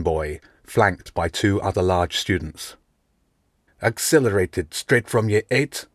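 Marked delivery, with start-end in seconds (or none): 3.8-5.9 sneering mocking question; scowling bully. A narrator's reading. Big bully mocking Nick's acceleration, scowling. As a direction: scowl and sneer at the new kid. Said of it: "the man boy said with a scowl".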